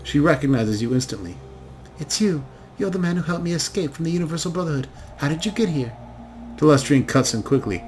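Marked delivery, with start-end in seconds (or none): 2.0-5.9 put-on higher, breathless young woman startled to see him; a haunted young elf blurting out her surprise; young elf daughter. Haunted daughter recognizes her rescuer, higher and quick.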